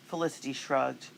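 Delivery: usual speaking voice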